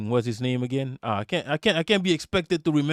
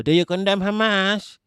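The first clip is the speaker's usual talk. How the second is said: mocking interviewer; 6 semitones higher, 3 dB louder than usual. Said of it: "made fun of Piers Morgan for".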